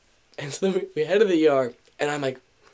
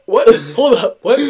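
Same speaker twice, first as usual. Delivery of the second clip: gasp, then loud high double take; acted shock, voice jumping up; alarmed disbelief; 6 semitones higher, 9 dB louder than usual. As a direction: balk loudly in comic alarm. Reacting to being told about saw.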